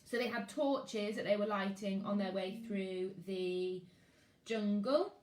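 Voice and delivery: ordinary delivery, nothing marked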